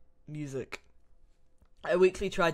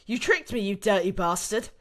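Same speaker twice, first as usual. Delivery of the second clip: higher, louder accusation; outraged captive; 4 semitones higher, 6 dB louder than usual. Acted. Ashley accusing Krauser of betrayal, indignant and loud. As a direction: lash out at the one who tricked you.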